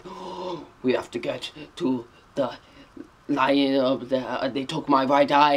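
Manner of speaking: lightly mimicking an over-the-top movie actor, dropping soft; a hint of Nicolas Cage's hammy line reading; Nicolas Cage impression. Reviewer mocks the actor's dialogue, soft mild impression. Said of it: "he talks like that".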